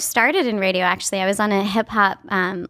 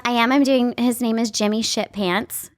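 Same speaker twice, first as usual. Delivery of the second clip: ordinary talk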